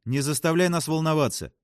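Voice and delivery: high, insistent reproach; exclaiming. A narrator's reading. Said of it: "воскликнула Джессика". (восклицая, высоким голосом)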